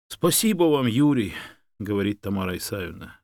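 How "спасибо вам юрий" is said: higher, heartfelt thanks; grateful woman. A narrator's reading. Woman thanking the man who found her dog, grateful. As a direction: thank him with feeling.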